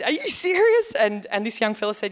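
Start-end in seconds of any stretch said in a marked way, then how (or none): 0.0-0.9 much higher, louder astonished question; can't believe what he's hearing; shocked disbelief. Retold or imitated. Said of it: "was very shocked".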